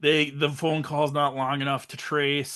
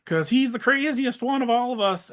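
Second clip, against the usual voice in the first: higher-pitched mock girls; 11 semitones higher than usual. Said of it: "everyone's like".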